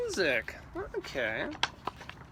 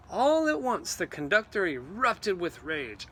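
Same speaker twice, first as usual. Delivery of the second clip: ordinary delivery, nothing marked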